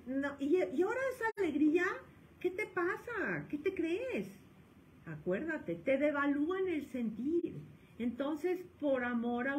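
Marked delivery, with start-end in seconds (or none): 0.1-4.4 scolding parent. Retold or imitated. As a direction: nag the kid at the table. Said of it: "Porque me dice". (padre regañando a su hijo, en voz alta y burlona)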